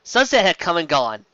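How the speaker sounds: usual speaking voice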